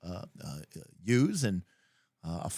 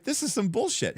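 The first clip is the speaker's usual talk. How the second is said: mock indignant player; 10 semitones higher than usual. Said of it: "and you're like".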